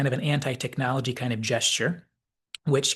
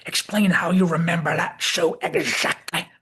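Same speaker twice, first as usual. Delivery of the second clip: put-on taken-aback old Southerner, higher and louder; raised, incredulous Southern old-timer; startled McCoy; 5 semitones higher, 5 dB louder than usual. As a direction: demand it in a startled old man's voice. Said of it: "taken aback and kind of startled".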